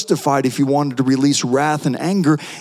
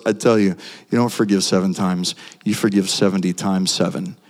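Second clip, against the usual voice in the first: solemn Jesus reply; 9 semitones lower than usual. Retold or imitated. Jesus answering Peter.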